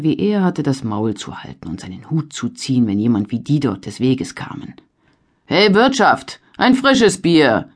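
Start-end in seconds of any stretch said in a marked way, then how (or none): 5.4-7.7 laut und höher durch die Stube gerufen. A narrator's reading. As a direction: ruf nach dem Wirt, gedehnt. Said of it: "rief er". (laut nach dem Wirt rufend)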